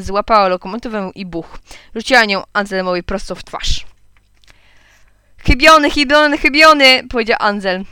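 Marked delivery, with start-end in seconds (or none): 5.4-7.0 put-on high, loud childish jeer; loud taunt. A narrator's reading. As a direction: crow it mockingly at the one who missed. Boy taunts after the miss. (głośne, wysokie przedrzeźnianie)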